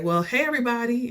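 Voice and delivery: high, cheery greeting, imitated; jovial and happy. D greeting the waiting family, jovial and happy. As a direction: mimic someone greeting all joyfully. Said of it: "she's all jovial and happy".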